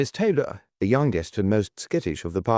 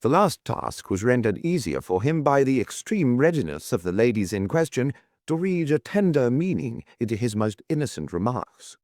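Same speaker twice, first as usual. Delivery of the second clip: ordinary delivery, nothing marked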